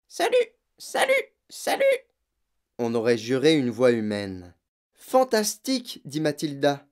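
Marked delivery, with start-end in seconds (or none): 0.1-1.8 very high, squeaky parrot voice; squawking parrot. A narrator's reading. Parrot suddenly greeting Matilda and Fred, squawking. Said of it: "le perroquet cria". 5.0-6.0 high, drawn-out delight; thrilled. A narrator's reading. Matilda to Fred about his parrot, thrilled.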